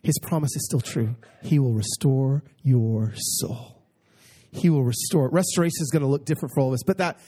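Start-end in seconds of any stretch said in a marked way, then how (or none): 0.0-3.8 slow and tender. Acted. Pastor's gentle promise.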